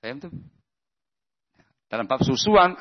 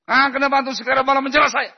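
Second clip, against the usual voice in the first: much higher, louder and fast, aggrieved complaint; indignant protest; 13 semitones higher, 4 dB louder, faster than usual. Pretend. Apologiser snaps back at the angry friend, indignant and high. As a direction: protest that you already apologised, voice rising. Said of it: "Jangan mengatakan".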